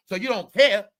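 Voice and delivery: ordinary delivery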